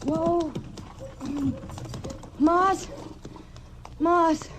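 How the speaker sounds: reining in the horse, then calling for Moss. Acted. Reining in horse, calling stable man.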